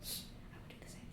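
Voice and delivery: hushed, fast whisper. Acted. Kane whispering to Lolth that he would do the same, quick whisper. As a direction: shush them and whisper it quickly. Whispered aside between characters.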